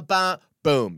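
ordinary delivery

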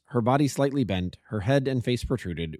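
usual speaking voice

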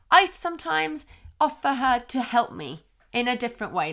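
usual speaking voice

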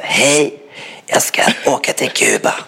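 a mock voiceless plea at the airport desk; lost voice. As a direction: squeeze it out with no voice left. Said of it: "it's just my voice that I lost".

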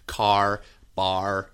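CAR and BAR with strong held final R; hard American R. Shown as a demonstration. Teacher demonstrating American rhoticity, hard R's. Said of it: "they hit those R's really hard".

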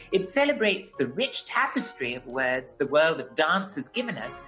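ordinary delivery

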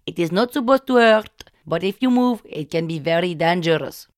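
slow, insistent Indian English warnings; Indian-accented healer. Retold or imitated. Healer warns patient not to move, insistent Indian accent. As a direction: repeat the danger like a mantra, Indian accent.

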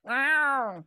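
long, high, loud meow; drawn-out imitated cat cry. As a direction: yowl it out like a cat. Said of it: "perform Hamlet as a cat".

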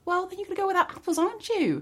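high, fast mocking retort; snarky shopkeeper. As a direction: toss it back, smug and quick. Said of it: "They'd be like".

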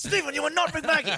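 hammy, high correction; scolding reply. Retold or imitated. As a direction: rebuke an actor through amusement. Acted reply to the bursting-in actor.

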